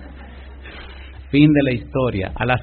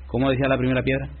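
ordinary talk

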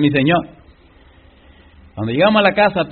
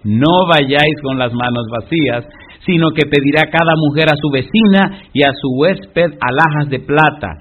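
preaching scripture; 2 semitones higher, 5 dB louder than usual. Acted. Reads Exodus verses aloud.

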